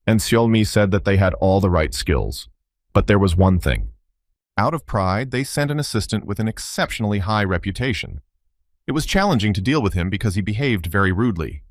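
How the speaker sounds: ordinary delivery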